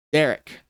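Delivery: Darish said on purpose as "Derek"; plainly calling him "Derek"; deliberate mispronunciation. Shown as a demonstration. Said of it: "I will say it as".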